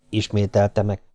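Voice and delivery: plain reading voice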